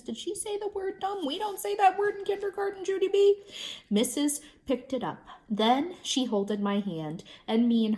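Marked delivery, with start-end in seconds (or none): 0.0-3.4 higher, mock-shocked scolding. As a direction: gently tell off a naughty kid. Reader scolds character playfully.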